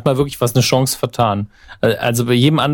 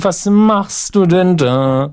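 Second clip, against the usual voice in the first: aggressive; 6 semitones higher, much slower than usual. Shown as a demonstration. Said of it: "diese Aggression rausgelesen".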